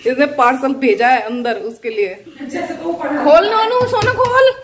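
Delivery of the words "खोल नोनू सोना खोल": high, loud squeals urging the pets on; excited high coaxing. Real, not acted. Mother squeals at her pets to open the parcel.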